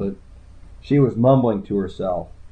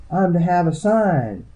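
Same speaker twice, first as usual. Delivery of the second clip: high-pitched plaintive wail; high, pleading wail; 9 semitones higher than usual. A narrator's reading. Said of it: "she wailed".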